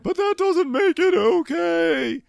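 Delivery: high, loud, slow squeal; hammed-up high protest; imitating Robin. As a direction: squeal the objection. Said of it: "Robin's like".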